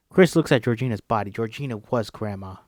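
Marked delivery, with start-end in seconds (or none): none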